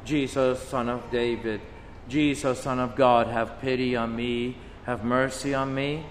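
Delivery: soft, low pleading prayer; hushed plea for mercy; quiet plea. Shown as a demonstration. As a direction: murmur it as a humble plea. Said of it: "do we cry out".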